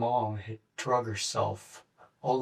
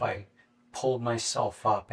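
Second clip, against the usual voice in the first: ordinary talk, nothing marked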